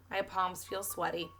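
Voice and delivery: usual speaking voice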